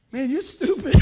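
loud high laughing jab; buddy cracking up. Retold or imitated. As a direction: tease through laughter. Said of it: "My buddy said".

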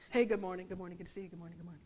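low, fading murmured good-mornings; hushed greetings. Retold or imitated. Greeting people while stepping over them, hushed. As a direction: murmur polite greetings under your breath.

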